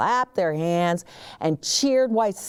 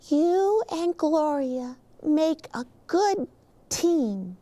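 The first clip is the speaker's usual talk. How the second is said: lightly voiced little girl, higher; child's letter; 7 semitones higher than usual. Claire writes to Officer Buckle, lightly childlike voice. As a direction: read it like a small child would.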